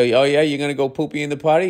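lightly voiced dad humoring his kid; playing along dad. Dad talking back to toddler.

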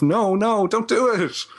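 anxious pleading. Acted. Film fan willing characters not to say it, anxious pleading. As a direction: plead with the screen in playful dread. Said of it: "saying to yourself".

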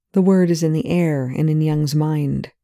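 usual speaking voice

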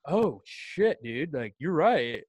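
acted-out dawning realization; drawn-out mock revelation; won-over drinker. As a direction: imitate a skeptic suddenly convinced. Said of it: "and they're like".

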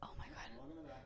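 breathy shocked whisper. Real, not acted. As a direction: whisper it, startled. Startled by the mock alarm.

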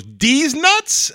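put-on high squeaky kid retort; cheeky kid. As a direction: squeak it like a smirking boy. Kid's crude punchline.

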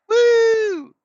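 very high, loud woo; mock victory whoop. Dieter cheering five pounds lost, high whoop. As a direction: celebrate like you won. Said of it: "you're like".

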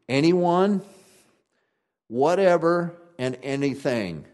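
Anyone and whatever called out high and loud; preacher spelling out key words; stressing each word. Shown as a demonstration. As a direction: hit Anyone, whatever, anything one by one. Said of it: "three words I want you to listen to".

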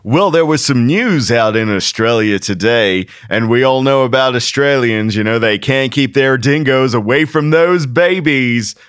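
milk the joke like a late-night monologue; US talk show host. Retold or imitated. Mocking how American TV jokes about Australia, hammed-up talk show host. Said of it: "I'm a U S talk show host guy".

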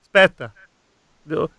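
about to sneeze; talking through a held sneeze; holding back a sneeze. Real, not acted. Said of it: "Devo starnutire, ma non mi è venuto".

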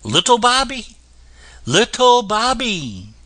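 high calling voice. A narrator's reading. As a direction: call his name from afar. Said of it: "hearing people call".

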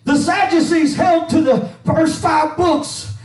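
rhythmic preaching with an "uh" on each breath; full-force preaching. Acted. Pastor preaching to his church, full-force and rhythmic. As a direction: preach it out to the church over the organ. Preaching over organ music.

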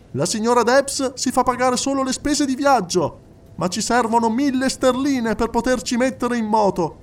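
più alto e forte, da oratore che chiede offerte; appello accorato. A narrator's reading. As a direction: arringa la sala con fervore. Segretario fa appello dal palco. (appello alla folla, voce alta e forte)

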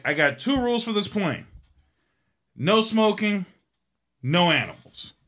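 a hint of a stern pilot laying down rules; lightly voiced strict captain. Strict pilot states plane rules.

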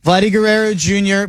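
ordinary talk

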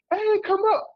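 high mock call from a balcony. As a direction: call down high, like girls inviting someone up. Girls calling down from balcony.

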